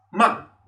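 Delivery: "ma" falling sharply from high; short falling Mandarin tone on "ma"; fourth tone. Shown as a demonstration. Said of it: "tenemos el cuarto tono".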